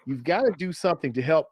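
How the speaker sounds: ordinary talk, nothing marked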